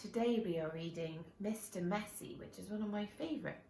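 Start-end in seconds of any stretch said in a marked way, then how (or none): none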